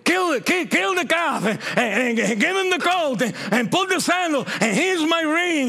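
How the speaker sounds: the father welcoming his lost son home, jubilant; joyful father. Acted. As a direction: shout the orders for the feast, overjoyed.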